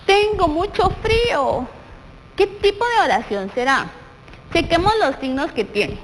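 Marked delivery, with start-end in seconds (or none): 0.0-1.6 "Tengo mucho frío" read higher and slower, with a lifted "frío"; exclaimed cold complaint. Shown as a demonstration. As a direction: act out shivering, stretching "frío". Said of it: "son los signos de exclamación".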